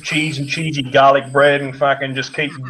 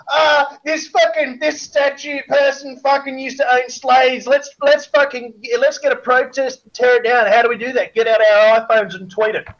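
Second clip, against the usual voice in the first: mock activist; 7 semitones higher than usual. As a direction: parody a preachy student activist. Mocking social justice warriors.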